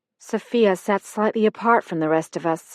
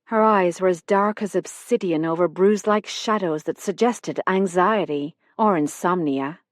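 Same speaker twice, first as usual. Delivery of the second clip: usual speaking voice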